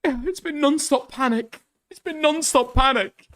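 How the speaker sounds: high, dragged-out mocking tone; mocking whiny voice. Mocking the video's line twice.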